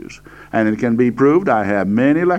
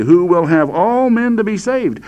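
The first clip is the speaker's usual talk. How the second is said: "all" raised high in the verse; stress on all; 7 semitones higher than usual. Shown as a demonstration. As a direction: recite it with a lifted "all". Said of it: "I'm emphasizing the word all here".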